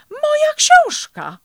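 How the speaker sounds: very loud, very high exclamation; shrieked surprise at a find; astonished cry. A narrator's reading. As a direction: cry out in amazement, pitch leaping. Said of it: "zawołał zdziwiony żółwik".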